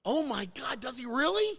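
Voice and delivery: high, delighted disbelief; astonished question, voice lifted high; touched surprise. Real, not acted. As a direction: react with real amazement at the news. Learns the son kept his hat.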